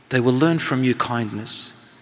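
ordinary delivery

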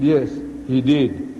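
low, drawn-out acted confession; slow, reluctant admission. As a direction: concede it slowly, word by word. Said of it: "the Maulana started slowly".

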